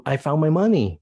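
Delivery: a happy little cheer. Shown as a demonstration. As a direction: sound thrilled to find it. Teacher acting happy example.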